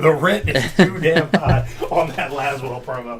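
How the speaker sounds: slow, laughing through the words. Pretend. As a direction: laugh while repeating the line slowly. Repeats own joke laughing.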